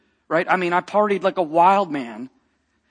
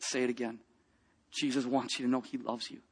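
hushed; 5 semitones lower, 12 dB quieter than usual. Retold or imitated. Quiet message from God, repeated.

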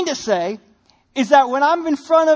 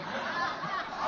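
breathy chuckle; 15 dB quieter than usual. Real, not acted. Laugh after line.

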